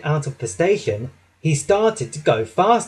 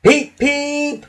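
high-pitched engine whistle peep; loud whistle; 11 semitones higher, 3 dB louder than usual. A narrator's reading. Thomas teasing tired Gordon, whistling loudly. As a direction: imitate a train whistle, high and piercing. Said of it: "whistled loudly".

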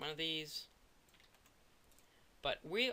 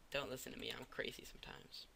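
whispering; 2 semitones lower, 8 dB quieter, faster than usual. Real, not acted. Muttered aside after a mistake.

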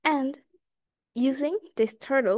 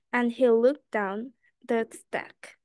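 plain reading voice

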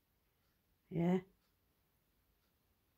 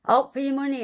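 loud, high, bitter jab about money; angry outburst; 7 semitones higher, 7 dB louder, faster than usual. Real, not acted. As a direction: spit it out at them in anger. Ranting at the association.

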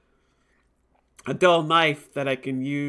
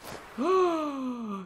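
sharp high gasp; startled intake of breath; surprised gasp; 11 semitones higher than usual. Real, not acted. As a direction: gasp at the reveal. Shocked by ghost heart.

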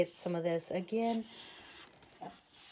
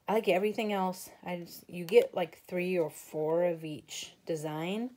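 usual speaking voice

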